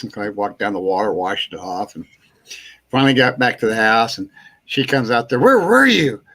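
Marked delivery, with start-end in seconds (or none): 5.4-6.2 worried call. Retold or imitated. Wife calling out, worried.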